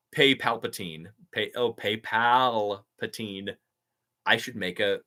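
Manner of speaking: ordinary talk, nothing marked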